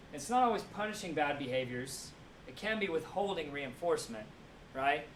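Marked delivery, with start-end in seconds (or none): none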